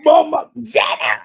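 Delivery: high loud straining name call; hoarse announcer yell. Shown as a demonstration. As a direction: yell it till your voice goes. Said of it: "lose your voice when you say".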